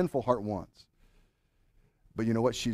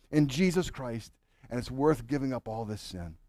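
usual speaking voice

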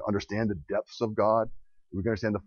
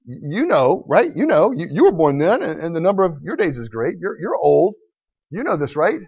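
sarcastic God; 7 semitones higher, 5 dB louder than usual. Acted. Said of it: "God's being a little bit ironic there. He's sarcastic".

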